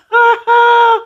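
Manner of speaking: loud, very high peal of laughter. Real, not acted. Storyteller tickled that Sharice did it, cackling. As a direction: burst out laughing in a squeal. Laughs at her own joke.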